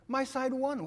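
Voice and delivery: retelling his wife's triumphant outburst; wife's excited cry. Retold or imitated. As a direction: throw it out like a proud fan. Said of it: "She said".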